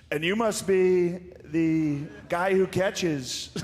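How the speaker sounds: hammed-up Trump greeting a player; Donald Trump impression. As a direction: drag it out like a puzzled president. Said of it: "why do you do his voice pretty good".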